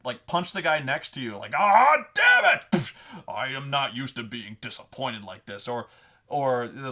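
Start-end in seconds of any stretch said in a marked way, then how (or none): 1.5-2.7 loud, high-pitched acted roar; angry outburst. Player punching the guy next to you, angry shout. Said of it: "Just say something angry". 2.7-5.7 acted anger. Intimidating character after punching someone, acting displeased. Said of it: "Just say something angry".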